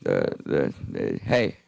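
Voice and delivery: creaky dropped fry; low creaky stammer; vocal fry. Shown as a demonstration. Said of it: "I'm pretending like the note".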